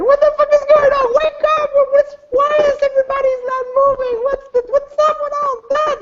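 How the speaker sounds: fast, very high hysterical yelling; girl screaming. Acted. Girl in the back of the club, hysterical high screaming. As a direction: scream frantic questions in a rush. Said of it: "the girl's like".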